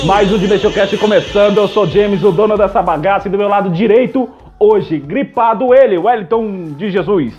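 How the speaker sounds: podcast host intro. Acted.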